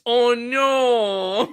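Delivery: a sing-song fake oh no; mock dismay. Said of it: "as we learn through our, our convention experience".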